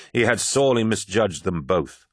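usual speaking voice

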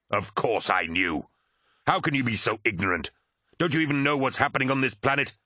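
indignant retort, pitch climbing; acted scorn. Commander mocking his crewman's ignorance, scornful. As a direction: scoff at their ignorance, building in intensity.

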